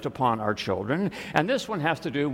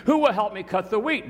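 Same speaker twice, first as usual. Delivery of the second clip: high-pitched, appealing question; storybook hen asking; 7 semitones higher than usual. A narrator's reading. Little red hen asks for help with wheat, high storybook voice. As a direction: pitch it up like a children's tale.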